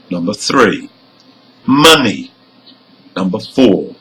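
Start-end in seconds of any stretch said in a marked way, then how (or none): 1.6-2.3 "money" stressed on MON, light ending; first-syllable stress. Shown as a demonstration. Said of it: "the first syllable".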